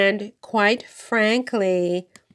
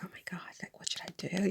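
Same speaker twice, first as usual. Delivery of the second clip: very soft, breath-only whisper; imitated whisper; 16 dB quieter, much faster than usual. Speaker to store owner in the presence of royalty, whispering. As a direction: whisper it as if afraid to be overheard. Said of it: "I was whispering with her".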